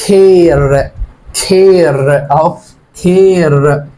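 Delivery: كير slow, a said like ay; reading care aloud. Shown as a demonstration. Teacher reading كير slowly for the class. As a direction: draw out كير with the ay sound. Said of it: "فابدأ اقول ايه؟".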